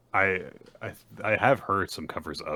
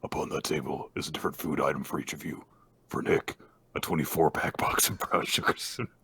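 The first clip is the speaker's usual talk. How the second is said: hushed, whispery villain voice; sinister half-whispered instructions; creepy killer laying out the game; Jigsaw. Acted. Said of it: "would you have the more appropriate, uh, Jigsaw voice".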